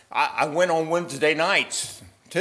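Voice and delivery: anxious man adding deeds; eager man. Acted. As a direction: push another good deed hopefully. Man adds more deeds.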